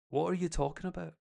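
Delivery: lightly imitated American accent. American students baffled by the speaker's talk, mock American accent. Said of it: "in their wee American accent".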